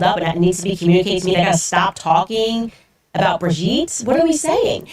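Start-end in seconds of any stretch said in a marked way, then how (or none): 4.0-4.9 incredulous. Retold or imitated. Incredulous about the call.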